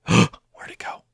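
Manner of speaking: breathy whispered question after a gasp; gasp, then whisper it in puzzlement; hushed surprise. Real, not acted. Player losing sight of a dropped item, hushed surprise. Item vanishes while he demonstrates.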